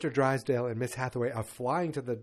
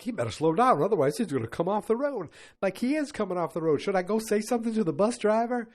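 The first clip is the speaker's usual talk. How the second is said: higher, louder retold worry; mock alarm; 8 semitones higher, 5 dB louder than usual. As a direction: act out a naive passenger getting alarmed. Alarmed passengers think plane is bus.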